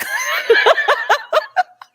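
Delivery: very high burst of laughter; real high-pitched laugh; high laughing shriek. Real, not acted. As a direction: shriek with delight. Laughs at grandma clicking TV off.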